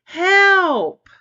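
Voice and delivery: high, loud desperate scream; a trapped friend screaming for help. Acted. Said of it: "Susie screamed".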